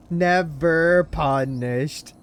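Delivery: slow, high, loud drawn-out glee; delighted drawl; gleeful. Real, not acted. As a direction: savor every word with delight.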